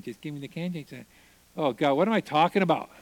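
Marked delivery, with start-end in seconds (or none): 0.0-1.1 soft, muttered to himself; replaying the words under his breath; quiet inner thought. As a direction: murmur it alone at night, lying awake. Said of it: "I laid in bed at night thinking".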